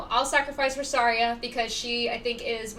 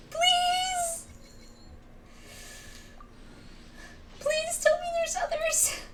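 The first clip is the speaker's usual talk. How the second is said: real shrieking plea; panicked pleading. Real, not acted. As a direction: beg the game not to do this. Bad pull, panicked begging.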